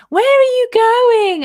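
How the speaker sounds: put-on high, loud, drawn-out drunken call; drunk friend. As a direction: mimic a drunk mate calling out, slow and high. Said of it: "I see her swaying behind me".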